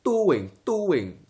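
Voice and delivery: "doing" with a hard, punched D; "doing" falling sharply from a high pitch; pointed D. Shown as a demonstration. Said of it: "they have to be very poignant".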